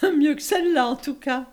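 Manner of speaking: laughing. Acted. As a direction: burst out laughing at it. Laughs at stage character.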